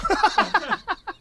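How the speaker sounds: high-pitched burst of laughter. Real, not acted. Laughing at the memory.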